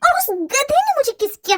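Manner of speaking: high, indignant fury at being kissed; furious outrage. Acted. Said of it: "मुझे बहुत गुस्सा आ रहा है".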